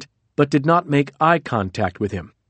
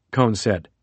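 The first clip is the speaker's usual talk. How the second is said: usual speaking voice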